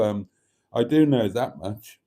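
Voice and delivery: ordinary talk, nothing marked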